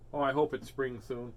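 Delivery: ordinary delivery, nothing marked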